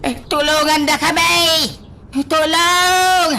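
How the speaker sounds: trapped father calling for rescue, shouting; shouting for help. Acted. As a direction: yell for rescue.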